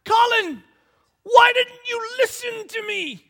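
put-on high, loud, slow shout at the child; frustrated shout. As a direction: yell it at your son. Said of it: "grab Colin, I'm like".

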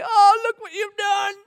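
high, loud, bitter accusation flung upward; indignant cry of blame; accusing shout. Acted. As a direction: shout the blame right at God. Blaming God for consequences.

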